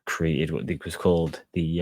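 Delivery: ordinary talk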